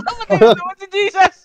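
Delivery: high-pitched outburst; excited callout. Real, not acted. Telling the squad Jesus died again, high excited callout. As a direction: cry it out high over the game. Calling out a teammate's death.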